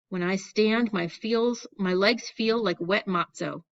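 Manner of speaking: plain reading voice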